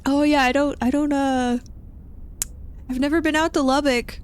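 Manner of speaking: high, careful placating voice; put-on agreeable hedging; awkward politeness. Acted awkward polite answer.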